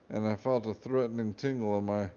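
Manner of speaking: plain reading voice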